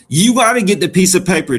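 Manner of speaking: loud, high insistent shout; emphatic yell. Acted. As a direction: drive the point home with a loud shout. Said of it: "Not yelling".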